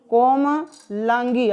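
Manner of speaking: कोमलांगी said slowly, ko-ma-laan-gee; कोमलांगी with each syllable clear; slow correct reading. Shown as a demonstration. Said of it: "अब की सही सही बोल दिया है ना".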